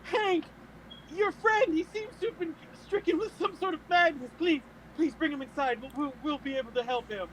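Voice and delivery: high-pitched, put-on panicked pleading; feigned alarm. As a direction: beg for help as if horrified. Feigning alarm, begging Hank for help.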